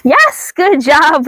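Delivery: high, slow, excited dog praise. Real, not acted. Trainer rewards her dog for slack leash, high excited praise. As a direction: stretch out a bright yes for the dog.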